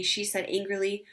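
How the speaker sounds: plain reading voice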